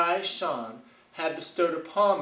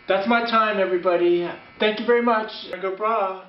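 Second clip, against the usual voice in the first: loud sign-off; 6 dB louder than usual. Real, not acted. Comedian's sign-off to audience.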